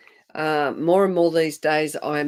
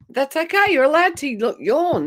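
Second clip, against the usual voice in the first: excited; 9 semitones higher, 5 dB louder than usual. Real, not acted. Reassures her about yawning.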